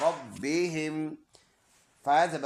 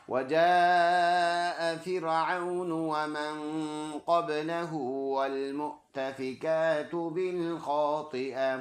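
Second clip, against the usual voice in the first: slow, drawn-out Quranic recitation; slower than usual. Acted. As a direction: recite the verses smoothly and measured. Recites verse slowly. (धीमी तिलावत)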